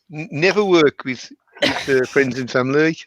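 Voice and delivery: slow, hesitant attempt at Yam Yam; badly faked Yam Yam accent, feeling for it. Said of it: "You can't even do a Yam Yam accent".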